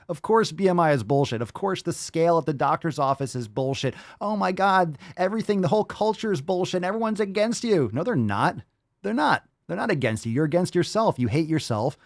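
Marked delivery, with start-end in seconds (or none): 4.2-7.9 higher-pitched mock complainer; mock whining. Reactor imitating people justifying themselves, mock whiny. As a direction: wail it like a put-upon victim, mockingly.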